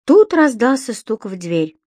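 ordinary delivery

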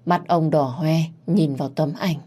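ordinary delivery

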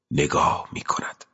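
soft whispered repeat; hushed echo of the line. A narrator's reading. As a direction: breathe the words out. Repeats the phrase, second whispered.

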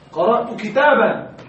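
slow model reading, كتابا as "kitaban"; correct accusative ending. Shown as a demonstration. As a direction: end كتابا on a clear "-an": kitaban. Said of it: "نقول المفعول منصوب".

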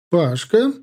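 loud, high greeting call. Acted. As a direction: hail him from across the room. Woman calls out his name.

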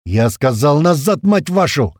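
loud order climbing in pitch, drawn out; angry roar. A narrator's reading. Commander repeating an ignored retreat order, angry roar. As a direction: roar at disobedient men. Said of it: "взревел Туман".

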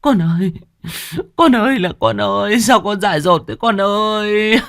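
high keening wail for a dead daughter; wailing grief. Acted. Grieving mother wails over daughter's coffin, high keening. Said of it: "rú lên khóc to hơn".

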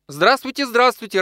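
high, delighted welcome; happy woman's eager hello; joyful greeting. A narrator's reading. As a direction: greet a guest with high-pitched joy. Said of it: "радостно приветствовала его".

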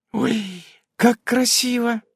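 high, slow wonder; delighted gasp. A narrator's reading. Hovering Nasa admires the speech, high gasp of delight. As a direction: breathe out admiration, pitched high. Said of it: "ахнула парящая рядом наса".

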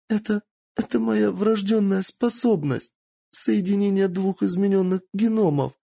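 put-on a scared girl rushing to confess; frightened captive girl. Captive girl answering her torturers, frightened and high.

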